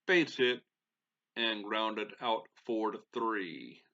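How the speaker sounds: measured play-by-play call of the inning; announcer voice. Acted. Gamer calling his own game as announcer, play-by-play voice.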